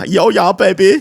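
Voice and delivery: high-pitched, loud mock Indian accent; hammed-up Indian accent, lifting at the end. Shown as a demonstration. Podcaster shows friends his accents, mock Indian accent. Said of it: "Here's an Indian accent".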